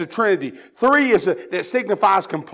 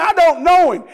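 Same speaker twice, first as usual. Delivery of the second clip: loud denial; 9 semitones higher, 8 dB louder than usual. Retold or imitated. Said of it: "He said".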